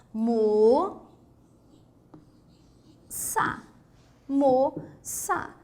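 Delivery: "Mo-, sa" in separate syllables, closed o held. Shown as a demonstration. Teacher sounding out the word, closed O. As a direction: split "Mo-" from "sa" with clear pauses. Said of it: "esse O já é fechado".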